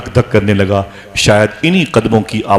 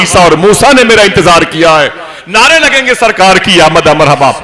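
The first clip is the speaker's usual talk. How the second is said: ecstatic proclamation; 6 semitones higher, 6 dB louder than usual. Acted. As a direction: proclaim it like joyous tidings from above. Unborn Prophet's voice announcing arrival.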